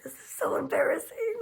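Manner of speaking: high, whispered and mortified; embarrassed whisper. Retold or imitated. Wife stuck against her husband in church, embarrassed whisper. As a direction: squeak it out under your breath.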